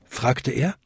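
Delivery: usual speaking voice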